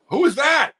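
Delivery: high, loud call from the back rows; distant heckle. Retold or imitated. Fan way up behind him calls out high and loud. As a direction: shout it across the arena.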